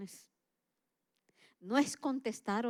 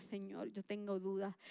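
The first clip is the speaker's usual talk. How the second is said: soft honest prayer; 3 semitones lower, 10 dB quieter than usual. Pretend.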